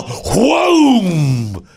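high mock whoom as the swing hits; cartoon swoosh effect; whoosh of the ride. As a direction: sound the ride sweeping her away. Ride slams into her.

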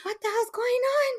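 high, stunned exclamation; shocked disbelief. Retold or imitated. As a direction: squeal it out in shock. Drunk, confused by phone.

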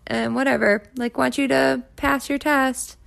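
high, sugary supportive upline voice; upline's sweet voice. Retold or imitated. Narrator imitating her upline's sugary support. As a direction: mimic the upline's sugary reassurance. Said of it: "and my upline was like".

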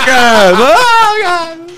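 high strained panicked cry; acting out bear attack, high panicked groan; mock terror. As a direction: cry out like being mauled. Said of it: "and just like".

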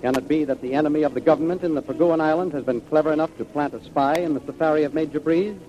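normal talking voice

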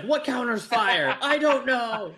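high, slow flustered voice; mock panic about a quiz; panicked high voice. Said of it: "Casey's just like".

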